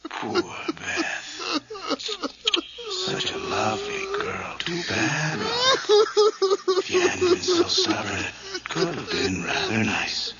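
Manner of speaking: high, whispery mocking croon; a ghost savoring its victory; sing-song creepy taunt; eerie gloating falsetto. Douglas gloats over Beth's death.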